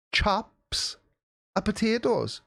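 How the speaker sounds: high Kiwi "chops" for chips; broad Kiwi accent. Pretend. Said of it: "Kiwi "chips" as chops".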